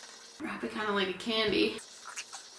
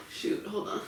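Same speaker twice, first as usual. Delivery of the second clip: whispered aside; 6 dB quieter than usual. Real, not acted. Forgot an egg, mutters aside.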